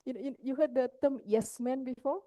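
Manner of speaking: ordinary talk, nothing marked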